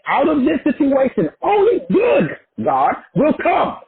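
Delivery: high-pitched, measured declaration, each phrase set apart; emphatic affirmation. Shown as a demonstration. Teacher models self-talk for a bad situation, emphatic affirmation. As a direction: declare it high, drawing out the final words.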